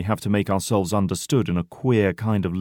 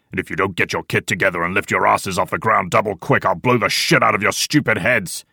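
loud threat without raised pitch; furious shout; 4 dB louder than usual. A narrator's reading. Old man threatens men to get moving, furious. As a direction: bellow a threat at the section. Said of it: "shouts the old man furiously".

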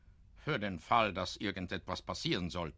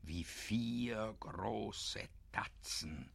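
slow, much softer, fading to a whisper; hushed suspense; 5 semitones lower, 12 dB quieter, slower than usual. A narrator's reading. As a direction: draw listeners in to the bear's steps. Narrator builds suspense.

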